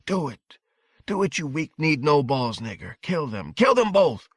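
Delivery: hector him, ending in a high shout; angry, hectoring. A narrator's reading. Evil voice in his mind urges murder, angrily hectoring. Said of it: "an angry, weakly hectoring voice".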